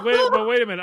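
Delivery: loud, high objection; indignant protest. Acted. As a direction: cut in, loudly baffled. Acting out indignant customer.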